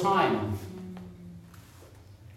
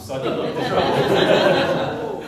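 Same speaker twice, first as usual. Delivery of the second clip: laughs the words out, slow and breathy; chuckling at his own confession; rueful laugh through the line; 3 semitones higher, 8 dB louder, slower than usual. Real, not acted. Confessing faked practice hours.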